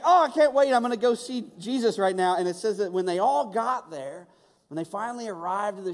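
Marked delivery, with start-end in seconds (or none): 0.1-2.4 high, loud, can't-wait rush; eager excitement. Retold or imitated. Peter eager to reach Jesus, high and loud. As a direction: burst with eagerness to go. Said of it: "he's just like".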